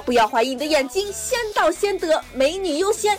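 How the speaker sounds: hyped-up TV sales pitch; over eager infomercial. Pretend. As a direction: sell it like a late-night ad. Mock advert for renting men.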